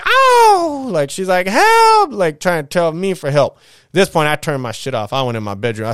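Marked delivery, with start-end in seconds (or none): 0.0-0.9 high, loud mock yelp; imitated high cry; mock cry of pain. Sister slapped, crying out. 1.4-2.1 high, loud mock scream for help; imitated high scream for help.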